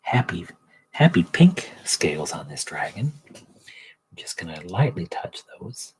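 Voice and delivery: hushed painting-show narration; Bob Ross. Retold or imitated. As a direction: imitate a TV painter murmuring to his canvas. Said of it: "The Bob Ross miniature painting".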